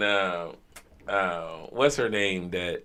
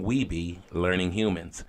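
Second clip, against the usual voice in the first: normal talking voice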